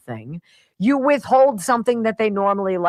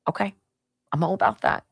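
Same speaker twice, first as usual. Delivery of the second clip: ordinary talk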